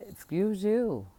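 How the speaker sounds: high, loud, sharp "excuse you"; sassy retort. Retold or imitated. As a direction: snap it back with full attitude. Sassy retort to dismissive friend.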